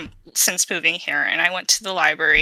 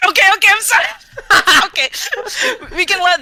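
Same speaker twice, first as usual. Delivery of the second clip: laughing apology; 11 semitones higher, 3 dB louder, much faster than usual. Real, not acted.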